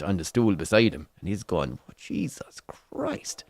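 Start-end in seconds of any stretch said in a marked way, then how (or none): 1.9-3.4 slow, drawn-out whisper; hushed, appalled groan; whispered dismay. Retold or imitated. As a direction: breathe it out in horrified disbelief. Dismayed thought in awkward silence.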